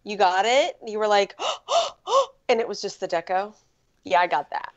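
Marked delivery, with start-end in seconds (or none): 1.4-2.2 sharp delighted gasp; excited gasp. Retold or imitated. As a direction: gasp in thrilled surprise. Excited gasp at rare deco.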